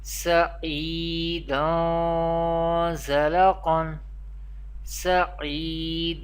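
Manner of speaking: "Sa'idan" with the final n held as a nasal hum into "Zala"; Ikhfa with ghunna. Shown as a demonstration. Teacher demonstrating Ikhfa in Arabic accent, nasalized drawn-out recitation. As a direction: recite "Sa'idan Zala Qan" slowly, sound taken into the nose on "dan". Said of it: "Here we perform Ikhfa".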